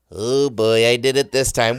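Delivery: loud, rueful self-reproach; comic retold dismay; rueful oh boy. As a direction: exclaim it like someone in big trouble. Retelling his reaction to wife's reproach.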